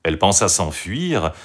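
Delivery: usual speaking voice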